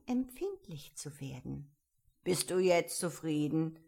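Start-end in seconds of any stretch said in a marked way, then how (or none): 2.2-3.8 firm, louder caterpillar; lightly voiced commanding caterpillar; caterpillar character voice. A narrator's reading. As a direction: question Alice as a stern caterpillar. Caterpillar questions Alice.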